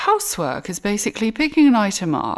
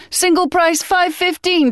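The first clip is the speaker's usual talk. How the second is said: hammed-up ad voice; 11 semitones higher than usual. Parody ad read, mock excitement.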